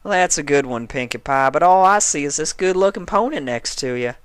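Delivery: lightly voiced country mare with a smile; Applejack smiling. A narrator's reading. Applejack teasing Pinkie, smiling lightly.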